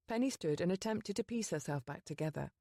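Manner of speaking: ordinary delivery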